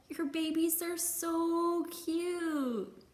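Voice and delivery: put-on Baby Bear gushing over the chicks; adoring. A narrator's reading. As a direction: coo it slowly like he's charmed. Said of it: "said Baby Bear".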